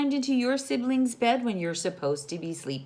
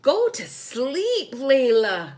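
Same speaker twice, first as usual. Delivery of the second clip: high, loud, slow groan of a tired sister; big sister begging her sister to sleep, groaning; 6 semitones higher, 8 dB louder, slower than usual. Acted. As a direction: drag it out, fed up and sleepy. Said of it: "groaned Kendra".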